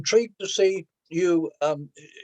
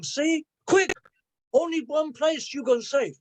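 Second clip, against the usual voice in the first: urgent warning; 7 semitones higher than usual. Acted. Poem's warning to the girl.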